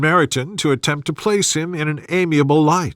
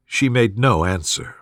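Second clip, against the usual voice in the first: ordinary delivery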